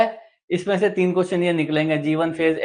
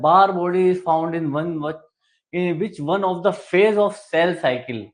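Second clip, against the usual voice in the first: slow question read; much slower than usual. Shown as a demonstration. Teacher reads quiz question aloud.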